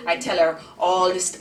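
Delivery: usual speaking voice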